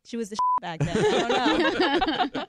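giggling through the words; laughing. Real, not acted. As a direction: laugh while speaking.

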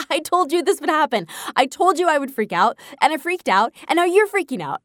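high, teasing I-told-you-so; playful scolding. Retold or imitated. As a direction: tease him for panicking. Continues scolding husband.

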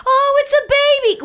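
awed wonder. Retold or imitated. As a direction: marvel slowly at the baby, high-pitched. Diana's wonder at a baby.

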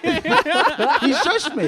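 squealing with laughter while talking; laughing. Real, not acted. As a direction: laugh through the words.

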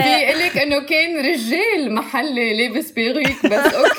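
laughing through the words; giggly, amused telling. Real, not acted. Jokes about local man in wig. (بضحكة)

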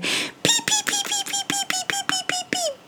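tiny high peeping chicks; very high, soft chick peeps; baby bird peeps. Acted. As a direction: peep like hungry baby birds. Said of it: "He opened his mouth wide and said".

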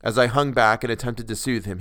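ordinary delivery, nothing marked

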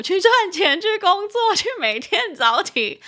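giggling through the chores; laughing. Real, not acted. Laughing list of daily grind.

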